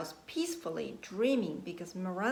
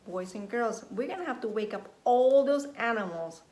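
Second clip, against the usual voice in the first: plain reading voice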